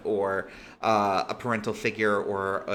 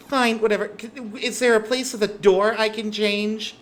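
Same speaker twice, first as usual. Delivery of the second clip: high, louder, huffy agreement; begrudging; 11 semitones higher, 5 dB louder than usual. Acted. Girl begrudgingly accepts the clothes, huffy and high. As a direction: give in with exasperation. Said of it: "She begrudgingly takes the clothes".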